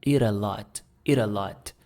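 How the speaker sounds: "eat a lot" with T flapped like a quick r, "ira lot"; American flap T. Shown as a demonstration. Teacher models American-style flap for students. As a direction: run "eat a" together as "ira". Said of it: "no sotaque mais americanizado".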